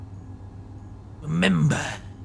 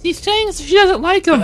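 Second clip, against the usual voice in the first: high squeaky pretend voice; 12 semitones higher, 4 dB louder than usual. Pretend interpreter voice.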